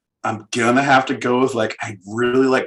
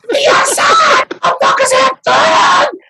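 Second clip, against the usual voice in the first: shouting; 7 dB louder, slower than usual. Acted. Yelled outburst.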